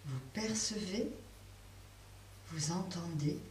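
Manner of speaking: ordinary delivery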